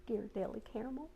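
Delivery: ordinary delivery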